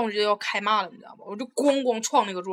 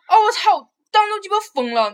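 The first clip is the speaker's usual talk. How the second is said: higher, louder, swearing through laughter; laughing outrage; 9 semitones higher, 5 dB louder than usual. Real, not acted. She tells her friend about the foot, laughing and outraged. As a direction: laugh and curse at once.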